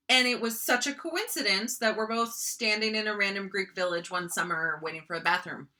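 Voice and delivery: ordinary delivery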